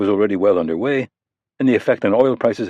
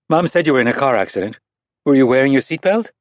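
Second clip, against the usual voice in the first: put-on high, earnest child voice; a young girl scolding a grown-up; little girl; 6 semitones higher, 3 dB louder than usual. Narrator voices young girl Anna.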